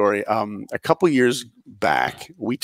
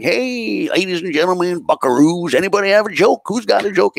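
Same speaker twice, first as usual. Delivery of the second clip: high, loud hammed-up cowboy announcer; hammed-up Old West host calling for jokes; cowboy emcee; 8 semitones higher, 4 dB louder than usual. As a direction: whoop it up like a train-show cowboy. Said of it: "He's like".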